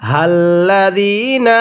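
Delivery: হাল্লাযীনা with the long vowel held one alif, slow; drawn-out Quranic recitation. Shown as a demonstration. Teacher demonstrating the long vowel to students, slow recitation. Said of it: "এক আলিফ টান দিব".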